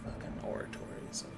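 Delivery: fast whisper to self; whispered mutter. Real, not acted. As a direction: mutter it under your breath. GM muttering while searching notes.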